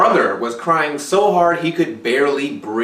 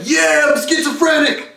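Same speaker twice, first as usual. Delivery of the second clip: mocking idiot voice; 12 semitones higher than usual. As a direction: ham up a proud idiot. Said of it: "those idiots are like".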